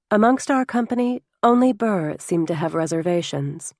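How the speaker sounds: plain reading voice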